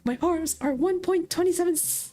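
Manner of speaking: a smug plushie gadget bragging to a rival toy; chipper robot toy. Acted. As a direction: pitch it up like a proud little toy. GM voices Plushie Pet.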